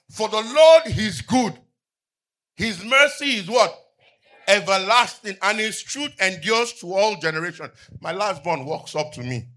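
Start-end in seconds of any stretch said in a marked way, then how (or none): 0.1-7.7 full-force preaching. Acted. Pastor proclaiming the psalm to his congregation, loud preaching. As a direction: thunder the verse out to the church.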